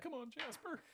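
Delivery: high, urging cheer. Pretend. Game master urging Jasper's attack roll, high cheer.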